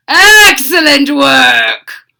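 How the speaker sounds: loud pleased mad doctor; praising mad scientist. Acted. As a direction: praise your creation. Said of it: "praised the doctor".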